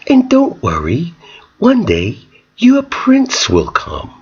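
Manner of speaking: high, hushed, slow tender coo; soothing mom. Retold or imitated. Mother comforting her crying daughter, hushed and sweet. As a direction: whisper it like comforting a child. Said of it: "rub the girl's hair and say".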